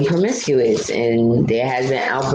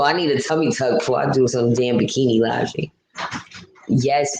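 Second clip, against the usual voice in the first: ordinary delivery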